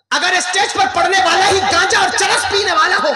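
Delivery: high, loud rhetorical challenge; full-force preaching. Acted. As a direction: thunder it out to the congregation.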